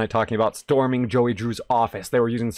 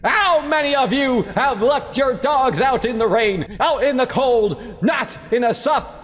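mock preacher; 10 semitones higher than usual. Gamer pretending to preach about dogs, hammed-up sermon. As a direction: preach it at full force, tongue in cheek.

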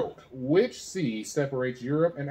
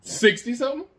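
incredulous; 12 semitones higher, 7 dB louder than usual. Real, not acted. Echoing a doubtful answer.

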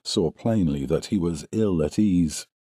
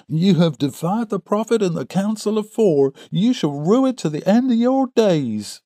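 very high-pitched righteous threat; enraged cry; 13 semitones higher than usual. A narrator's reading. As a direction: denounce him, pitch soaring with rage.

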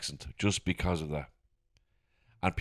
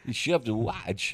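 badly faked actor's odd, halting delivery; Christopher Walken; 7 dB louder, much slower than usual. Shown as a demonstration. Performing his terrible impression for co-hosts, halting mock Walken. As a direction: ham up a terrible celebrity impression. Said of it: "do your Christopher Walken".

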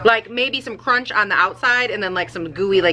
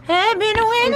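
put-on doggy baby talk; 12 semitones higher than usual. Cooing to her dog Winnie in high baby talk. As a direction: coo at the pup like a baby.

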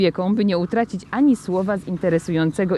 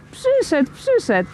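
high-pitched thrilled exclamation; excited child; 8 semitones higher, slower than usual. A narrator's reading. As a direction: cry out like a child finding presents. Child finds Saint Nicholas's gifts. (podekscytowany dziecięcy okrzyk)

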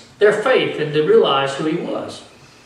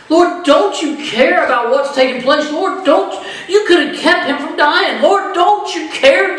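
high, urgent repeated plea with a half laugh; pleading sister; 8 semitones higher than usual. Retold or imitated. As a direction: keep pressing him with the question.

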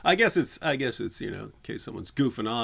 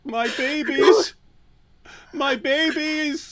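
very high, slow, loud wail; hammed-up drawn-out lament; mock wailing; 7 dB louder, much slower than usual. Creator mourning his deleted videos, mock wail.

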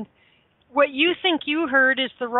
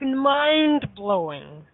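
very slow, stretched "mind-blowing"; drawn-out awe; much slower than usual. Real, not acted. Raving about her favourite recording, savoring each syllable. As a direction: let "mind-blowing" hang, slow and amazed.

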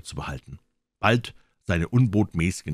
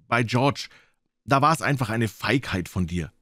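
put-on higher-pitched doctor, sternly scolding the boy; reproachful doctor; 7 semitones higher, 5 dB louder than usual. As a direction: give the boy a frank telling-off as the doctor.

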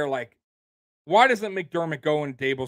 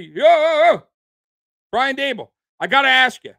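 loud, higher-pitched reporter calling out from the press room; pushy reporter; 6 semitones higher, 6 dB louder than usual. Pretend. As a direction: grab the coach's attention at the press conference. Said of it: "'Cause I, I would be".

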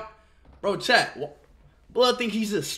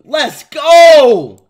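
big hyped yell at chat; loud hype shout; 13 dB louder than usual. Real, not acted. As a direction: belt out the cheer, much louder.

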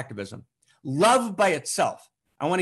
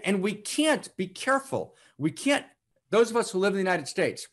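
ordinary talk, nothing marked

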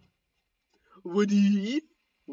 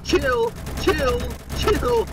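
high pitched; 9 semitones higher, slower than usual. Real, not acted. Panicking at teammate.

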